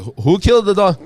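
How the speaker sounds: loud, high mock-indignant demand; mock outrage. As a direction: act out a feigned furious question. Dad's act of feigned outrage retold.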